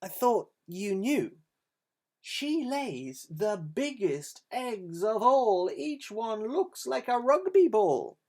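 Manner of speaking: goose luring the crooks on; sly goose. Acted. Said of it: "The goose replied".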